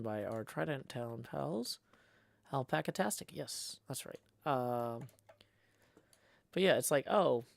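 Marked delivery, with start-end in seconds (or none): 3.3-4.2 whispered. Real, not acted. Aside while reading blocks.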